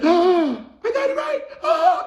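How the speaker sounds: very high, loud squealed gasps; imitated shock. Acting out the boy's look on hearing he won, shocked. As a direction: shriek in hammed-up, tearful disbelief. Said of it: "He was so shocked".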